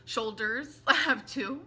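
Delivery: laughing at her own slip. Real, not acted. Joking with viewers about shoulders, laughing.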